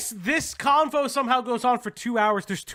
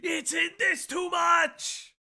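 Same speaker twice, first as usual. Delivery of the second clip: excited shout; 7 semitones higher, slower than usual. Real, not acted. Reacting to the clip.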